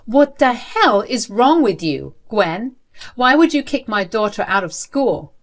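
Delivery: furious husband-in-law shouting at his mother-in-law; tearing into her. Acted. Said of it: "I absolutely tore into her".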